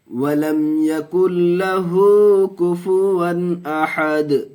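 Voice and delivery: slow, high, drawn-out chanted recitation; Quranic recitation. Shown as a demonstration. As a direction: intone each syllable long and melodic like tajweed recitation. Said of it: "teacher recites surah".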